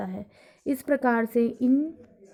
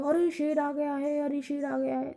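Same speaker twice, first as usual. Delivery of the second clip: mock shouted warning; 3 semitones higher, 4 dB quieter than usual. Shown as a demonstration. Teacher mimics someone calling out a lion warning. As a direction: raise the alarm about a lion. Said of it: "कोई चिल्ला के बोलेगा".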